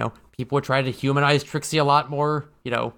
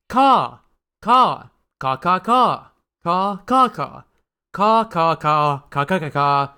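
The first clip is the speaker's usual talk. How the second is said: loud cawing like a crow; crow caws; 2 semitones higher, 4 dB louder, slower than usual. Pretend. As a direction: read the letter as a cawing crow. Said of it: "this one just says".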